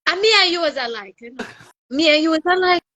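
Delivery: lightly voiced Jamaican robber demanding; Jamaican accent. Jamaican robber demanding his share in a Jamaican accent. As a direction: insist on the money in a Jamaican accent. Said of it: "a Jamaican and a Haitian".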